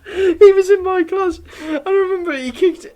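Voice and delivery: high-pitched talk, still giggling; recovering from laughter. Real, not acted. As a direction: try to carry on through leftover laughter.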